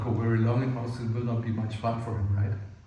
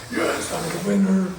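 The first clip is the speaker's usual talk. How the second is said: loud, high victory cry; mock triumphant; 7 semitones higher, 8 dB louder than usual. Wrestler whose partner just gave up, hammed-up triumphant shout. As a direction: crow it like a play-fight champion.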